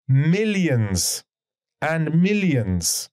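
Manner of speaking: millions and millions drawn out slowly with a pause; slow heavy emphasis. Shown as a demonstration. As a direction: stretch millions and millions to let it sink in. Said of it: "It's famous".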